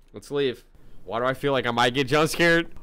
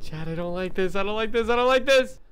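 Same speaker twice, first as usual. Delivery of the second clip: growing panic; 11 semitones higher, 5 dB louder than usual. Real, not acted. Scared streamer telling chat his fear keeps rising. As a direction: pitch climbing higher each repeat.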